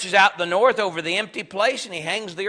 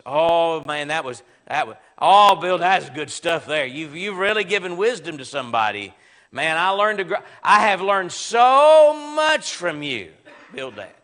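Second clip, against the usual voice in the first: sarcastic; 3 semitones lower, 4 dB louder than usual. Acted. Job telling Bildad how much he learned, sarcastically. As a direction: praise him with exaggerated, insincere awe. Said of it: "hear the tone in Job's voice".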